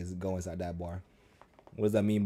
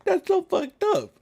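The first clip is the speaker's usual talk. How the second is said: high, loud shocked laughter; laughing in disbelief; 12 dB louder than usual. Real, not acted. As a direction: laugh it out, stunned. Shocked by stolen Lamborghini.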